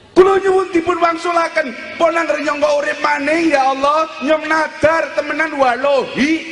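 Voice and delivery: acted pleading of the damned; crying plea. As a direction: bargain with God in a high wail. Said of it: "Kowe wong pada nangis".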